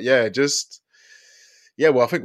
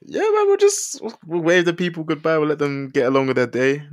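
cheery sign-off; 8 semitones higher, 5 dB louder than usual. Real, not acted. Signing off to viewers.

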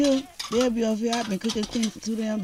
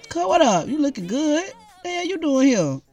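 much higher, delighted surprise; excited greeting; 9 semitones higher than usual. Acted. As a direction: greet your cousin with joy. Surprised greeting of a visiting cousin.

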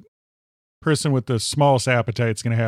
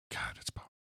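awed hushed whisper; 22 dB quieter than usual. Real, not acted. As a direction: whisper it in awe. Awed at the burger.

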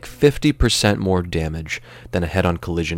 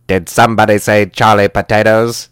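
loud, booming dramatic entrance; hammed-up movie-star boom; Sidney Poitier; 7 dB louder than usual. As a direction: announce yourself grandly from off screen. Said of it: "you're gonna hear Sidney Poitier off screen".

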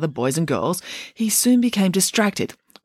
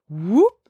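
short upward slide-whistle "whoop"; quick vocal swoop upward; rising whoop; 5 semitones higher, 3 dB louder than usual. Acted. Storyteller shows nose growing, short rising whoop.

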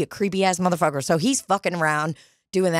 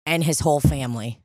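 normal talking voice

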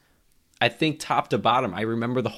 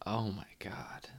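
whispered shock; 8 dB quieter than usual. Real, not acted. Reacting to gross movie scene.

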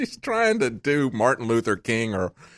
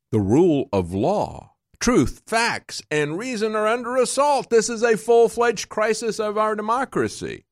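a hint of a lofty speech voice, pitched higher; mocking Hillary's speech; 11 semitones higher than usual. Host mock-reads Hillary's Selma speech to his audience, lofty and higher. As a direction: lightly send up her grand speechmaking.

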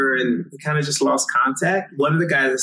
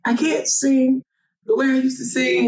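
high-pitched mock-upset plea; high, lightly acted tearful complaint; retold crying; 11 semitones higher than usual. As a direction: imitate a distressed kid on the phone. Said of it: "I called my uncle crying".

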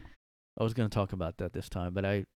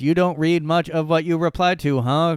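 raised, higher voice, defending hard; loud mock indignation; 6 semitones higher, 9 dB louder than usual. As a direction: read it like an offended fan. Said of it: "Jay, real person, says".

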